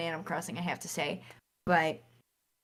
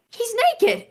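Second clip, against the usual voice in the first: high-pitched mock outburst; a quick high squeal, as mom; imitating surprised mom; 14 semitones higher, 4 dB louder than usual. Mom spotting the game hero is naked, retold in high surprise.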